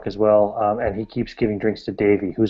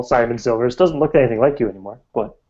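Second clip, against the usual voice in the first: ordinary talk, nothing marked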